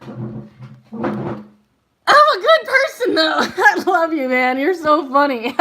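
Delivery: high, loud words through laughter; laughing hard. Real, not acted. Reader cracking up at a viewer's joke. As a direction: crack up mid-sentence.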